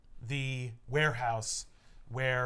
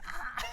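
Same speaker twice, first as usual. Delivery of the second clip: mock battle cry; 13 semitones higher, 6 dB quieter than usual. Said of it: "And he's like".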